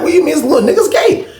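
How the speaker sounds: high-pitched, amused disbelief; startled, laughing comeback; incredulous question. Retold or imitated. Acting out his startled question back.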